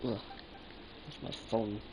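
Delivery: ordinary talk, nothing marked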